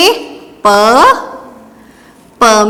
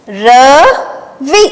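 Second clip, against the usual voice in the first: very slow, syllables drawn out; slow dictation; much slower than usual. Shown as a demonstration. Teacher revising dictation words, very slowly. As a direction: stretch it for the class. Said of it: "चलिए अब हम इन्हें फिर से दोहराते हैं।".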